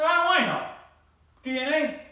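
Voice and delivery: loud, high comic shout; exasperated yell. Retold or imitated. Husband yelling back at his wife, loud and exasperated. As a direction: throw up your hands and holler.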